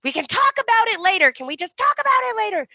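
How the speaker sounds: high, fast pleading gush; gushing confession. Retold or imitated. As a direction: rush out the love confession, high.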